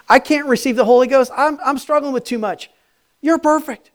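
loud, high, driving preacher voice; fervent preaching. Acted. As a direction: preach it out to the crowd with fire. Preaching at full force.

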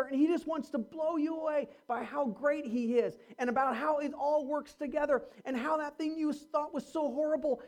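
usual speaking voice

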